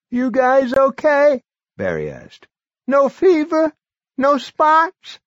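put-on high, loud, strained old man; a feverish old man pushing through; sick old man. A narrator's reading. Sick Barry voiced.

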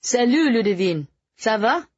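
high, light French greeting; friendly with a smile. Acted. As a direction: greet her warmly in French. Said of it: "Nick said with a smile".

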